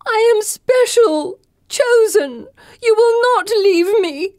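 high, loud, slow crying plea; desperate wailing. Acted. Pip wailing at the girls not to leave her. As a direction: beg and rage through tears.

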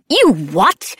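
loud, incredulous outburst; Madame Labelle, outraged. A narrator's reading. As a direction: cut in, appalled and loud. Mother figure outraged at confession.

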